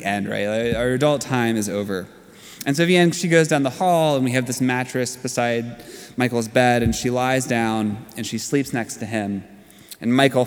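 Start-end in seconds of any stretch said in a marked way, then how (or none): none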